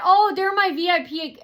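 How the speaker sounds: high, loud exclaimed notice; scripted announcement. Pretend. Waiter spotting VIP customers, loud announcement. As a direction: exclaim it as a staged interruption. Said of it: "and say that".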